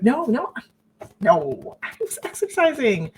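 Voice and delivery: high, pleading no no no; nervous wife insisting she was exercising, voice up high; frantic denial. Retold or imitated. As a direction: talk your way out, scared and high. Denying to jealous husband.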